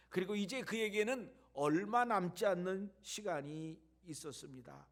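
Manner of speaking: ordinary talk, nothing marked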